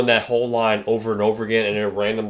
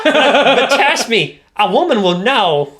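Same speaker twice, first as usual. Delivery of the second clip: high, slow, louder squawky woman; parrot voice; 11 semitones higher, 6 dB louder, slower than usual. Retold or imitated. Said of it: "sounded like a parrot".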